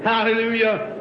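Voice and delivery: ordinary talk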